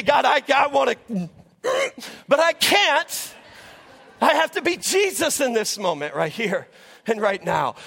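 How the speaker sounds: fervent sermon exhortation, punching each "be Jesus"; preaching. Acted. Pastor urges teachers, nurses and moms, preaching with fervour. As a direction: call them out to go live it.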